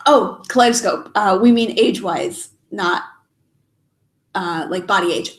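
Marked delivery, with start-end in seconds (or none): none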